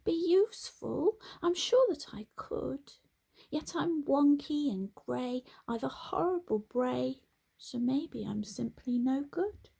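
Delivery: high, sad little donkey; a wonky donkey feeling useless. A narrator's reading. Donkey Dilly doubting herself, high and sad.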